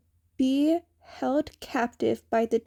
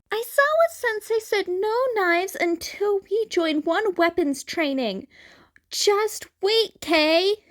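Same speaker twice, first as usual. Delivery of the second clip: put-on much higher, chiding young voice; high scolding Izuku; 9 semitones higher, 3 dB louder than usual. A narrator's reading. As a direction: scold your brother in a high boyish voice. Izuku scolds his twin.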